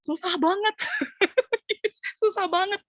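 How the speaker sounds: high, loud cry of dismay breaking into a laugh; dismayed exclaim. Retold or imitated. Preacher recalls hearing the Christmas backing track, high loud laughing dismay. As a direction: laugh it off, overwhelmed by the song. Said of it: "gua sampai merasa".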